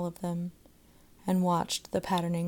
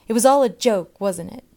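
loud, high jeering; mocking; 4 semitones higher, 12 dB louder than usual. A narrator's reading. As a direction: sneer it loudly for the crowd. Boy mocks Margot loudly.

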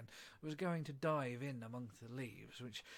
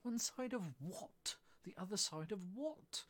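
high, drawn-out puzzled question; 8 semitones higher, slower than usual. Acted. Alice wonders what the caterpillar's riddle means, puzzled. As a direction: stretch out the question, pitched up.

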